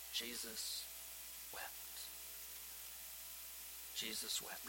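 barely above a whisper, reverent; hushed reverence over the shortest verse. Real, not acted. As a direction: let the words almost vanish.

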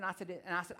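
ordinary talk

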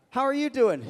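high, loud cheery greeting. Retold or imitated. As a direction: greet him brightly across the lot. Greeting stranger at gas station.

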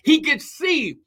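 full-force preaching, words stretched out; scripture preaching. Real, not acted. Preacher promising none can stand against you, scripture preaching. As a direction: declare it with mounting force, holding key words.

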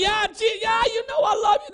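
lightly voiced eager Peter; high eager Peter voice. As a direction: pitch up and gush yes. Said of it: "he keeps asking him the question".

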